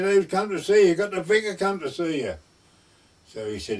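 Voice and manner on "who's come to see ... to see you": loud, high call across the room. Retold or imitated. Farmer's wife calling to her sick husband, loud and high. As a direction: raise the voice to wake up the old man.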